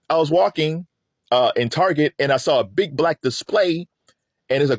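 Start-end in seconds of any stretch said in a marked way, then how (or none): none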